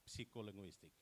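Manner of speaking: psycholinguistic starting p-see-ko; wrong, sounded p. Retold or imitated. Presenter shows what not to say, sounded p. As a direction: put a p before the s in psycholinguistic. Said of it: "not psy".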